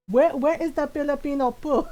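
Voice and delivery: Filipino-accented mock mom, insistent; mom's Filipino accent. Mom asking for Filipino food, in her Filipino accent. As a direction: imitate an indignant mom with Filipino-accented consonants. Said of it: "in my mom's voice".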